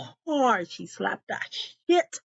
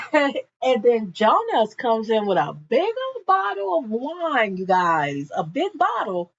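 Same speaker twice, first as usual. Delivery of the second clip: ordinary delivery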